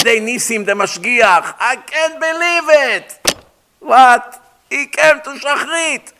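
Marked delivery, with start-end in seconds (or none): 1.6-3.4 amazed disbelief. Retold or imitated. Mashgiach amazed on phone. 3.8-6.1 amazed what. Retold or imitated. Amazed exclamation.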